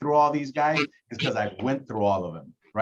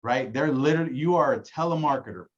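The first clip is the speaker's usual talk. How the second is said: normal talking voice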